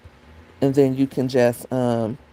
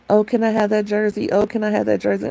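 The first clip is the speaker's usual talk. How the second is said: higher, mock pleading ex-husband voice; 6 semitones higher than usual. Ex-husband bugging her for her college jersey, mock pleading. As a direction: ask twice like a pestering friend.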